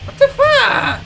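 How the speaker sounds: high, sudden what the fuck; jump-scare yelp of disbelief; startled outburst. Real, not acted. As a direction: blurt it out in shock. Startled by the chasing enemy.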